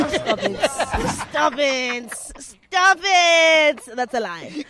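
loud laughing stop it; laughing protest. Real, not acted.

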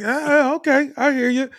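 squeaky high imitation of the barista; hammed-up high barista reply; high mock barista. Barista reacts, mimicked.

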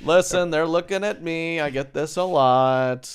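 high, loud, drawn-out bragging; hammed-up big-headed announcement; mock self-important boast. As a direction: stretch it out loud like a show-off.

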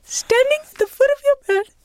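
very high South African friend impersonation; really high-pitched imitation. South African guest reporting a ghost at the bed, high-pitched imitation. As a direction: mimic her way up high, in a put-on accent. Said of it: "she talks like that really high pitch".

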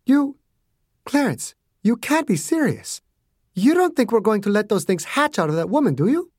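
high, appalled woman's voice; a touch of Margaret's shock. A narrator's reading. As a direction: plead with him in disbelief. Margaret horrified at Clarence.

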